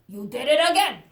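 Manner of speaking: loud, exasperated father; exasperated papa. Acted. Papa fed up with the chicken's interruptions, loud. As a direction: raise your voice in mock frustration.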